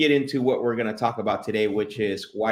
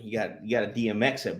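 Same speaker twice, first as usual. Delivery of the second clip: ordinary delivery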